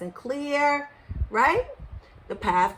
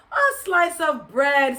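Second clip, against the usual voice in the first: slow, loud, higher answer; exasperated mother; 5 semitones higher, 5 dB louder than usual. A narrator's reading. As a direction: hammer out each word impatiently. Mother repeats slowly.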